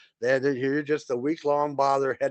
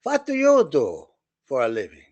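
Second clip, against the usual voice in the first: put-on German captain accent; 2 semitones higher, 5 dB louder than usual. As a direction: play the German captain holding court. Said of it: "I remember his voice".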